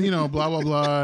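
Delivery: ordinary delivery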